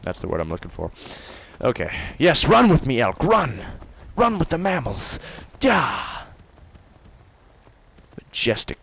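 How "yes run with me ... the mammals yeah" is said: joyful. Real, not acted. Running with elk joyfully.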